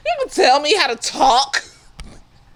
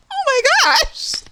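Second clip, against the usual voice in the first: exasperated; 14 semitones higher than usual. Acted. Exasperated disbelief.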